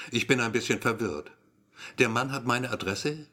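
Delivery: ordinary delivery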